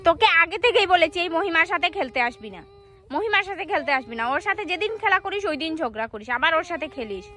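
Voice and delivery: loud mother's lecture; scolding mother. Pretend. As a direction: warn the child not to play with Mohima. Mother scolds her child.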